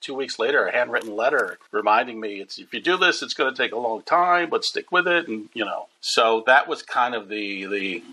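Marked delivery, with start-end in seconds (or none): 2.4-5.3 Rodney Dangerfield impression. Rodney's letter read in voice.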